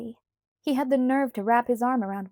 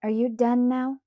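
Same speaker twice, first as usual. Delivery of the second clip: ordinary delivery, nothing marked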